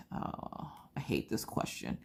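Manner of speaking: a muttered private groan; whispered aside. Retold or imitated. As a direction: whisper it under the breath. Private groan at dreaded question.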